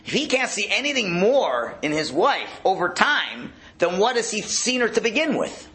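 usual speaking voice

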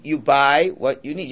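ordinary delivery

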